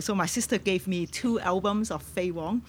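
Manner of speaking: ordinary talk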